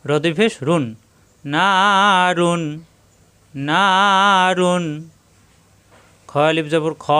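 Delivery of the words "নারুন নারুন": "নারুন" slowly, with long held "না"; one-alif madd. Shown as a demonstration. Teacher drills madd word, slow drawn-out "নারুন". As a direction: stretch "না", then close on "রুন". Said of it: "মাদের হরফ এক আলিফ টান".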